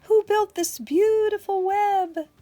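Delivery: high, loud hooting question; storybook owl. Acted. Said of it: "asked the owl".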